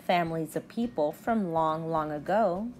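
gentle, lilting read-aloud of a rhyming picture book; reading to children. Acted. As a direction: read it like storytime on the rug. Teacher reads picture book aloud.

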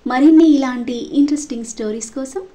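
soft, quiet sign-off read; channel outro. Acted. Storyteller closes the episode, asking for likes, softly. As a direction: gently wrap up and ask listeners to subscribe.

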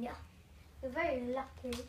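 normal talking voice

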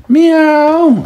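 loud high meow; cat meow. Acted. Grandma meowing loudly as Simpkin. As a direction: belt out a big cat meow. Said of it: "Simpkin said".

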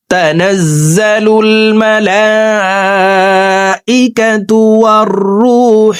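slow, drawn-out melodic chant; slow tajweed chant; Quran recitation. Acted. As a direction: intone each word long and reverent.

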